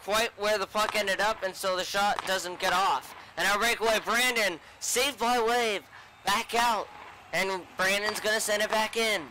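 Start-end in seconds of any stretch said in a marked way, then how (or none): 3.4-6.9 lively high play-by-play; rapid hockey play-by-play. Acted. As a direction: call the breakaway live. Live play-by-play.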